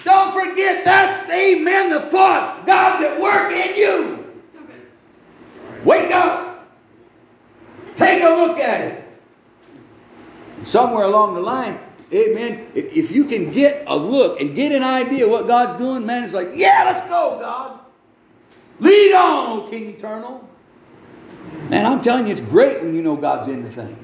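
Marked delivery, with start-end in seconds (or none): 0.0-9.0 high, loud preacher's cry; urgent pulpit shout; full-force preaching. Acted. 16.5-20.5 high, loud rallying cry; fired-up pulpit shout; eager shout. Acted. Preacher acts out eager response to God.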